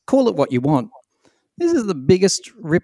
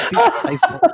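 laughing repeat. Real, not acted. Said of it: "Distasteful is what I'd call it".

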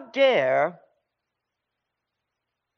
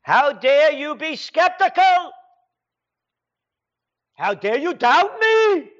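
mock haughty leader; 7 semitones higher than usual. Mocks leader again.

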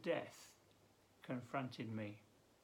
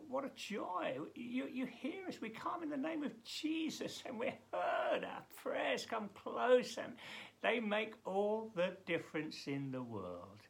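high, elated praise; rising delight; joyful prayer; 8 semitones higher than usual. Real, not acted. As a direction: rejoice as you pray.

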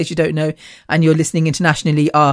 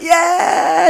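excited goal cheer; 10 semitones higher than usual. Retold or imitated. Retelling fans hugging as goals get scored, high excited cheer. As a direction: cheer like fans after a goal, pitched right up.

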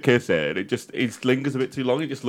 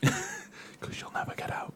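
hushed, laughing whisper; conspiratorial whisper; 14 dB quieter, slower than usual. Acted. Joking to friends about Meghan being trapped, whispered. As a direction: whisper it like a shared secret.

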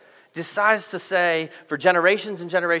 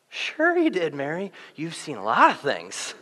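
patronizing disbelief; 6 dB quieter than usual. Retold or imitated. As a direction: humour her as if she's imagining things. Disciples humouring a doubted Mary.

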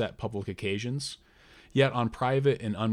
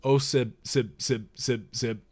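helpless stammer. Acted. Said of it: "I fell into a stammer".

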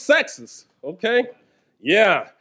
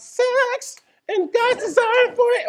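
much higher, slower, squeaky-excited pitch; slower than usual. Acted. Preacher to married couples, squeaky high voice. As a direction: jump way up into a falsetto on it. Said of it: "I got my high voice from that one".

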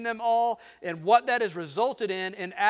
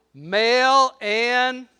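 slow, higher cue for the crowd; drawn out; 5 semitones higher, 8 dB louder, much slower than usual. Shown as a demonstration. Preacher prompting the congregation, slow drawn-out cue. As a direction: stretch it so they fill the blank. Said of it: "say that again".

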